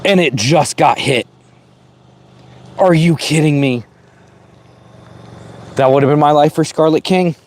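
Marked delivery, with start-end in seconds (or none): 2.8-7.4 exasperated. Real, not acted. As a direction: protest it, voice climbing. Rare snake killed by car.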